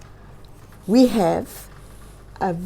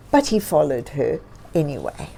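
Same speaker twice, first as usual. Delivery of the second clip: plain reading voice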